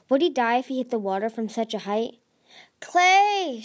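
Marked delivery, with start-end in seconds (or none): 2.8-3.6 shouting a name, much higher and louder; shouted. A narrator's reading. Tsunami shouts for Clay's help, high loud call. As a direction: call across to the cave. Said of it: "she shouted".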